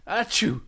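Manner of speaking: small acted achoo; pretend sneeze. Fish by the riverbank, little pretend sneeze. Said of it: "has a little sneeze".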